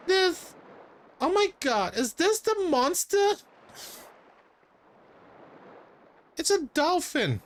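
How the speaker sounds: put-on high-pitched falsetto girl voice; put-on high girly voice; squealing girl character.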